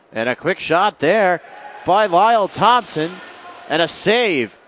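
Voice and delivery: loud, high play-by-play burst; excited save call. Real, not acted. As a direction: call a sudden chance at goal. Calling a shot and save.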